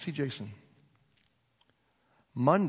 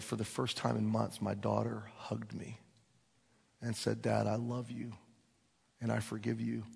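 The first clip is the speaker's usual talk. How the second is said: hushed tender; 6 dB quieter than usual. Retold or imitated. Tender reconciliation retold quietly.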